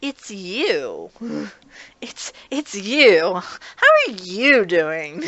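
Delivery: slow, flat, unwelcoming greeting; cold change of tone. Retold or imitated. Shigure realising Kureno is on the line, coldly slow greeting. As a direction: let the warmth drain out, coolly polite. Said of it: "his tone and attitude completely changed".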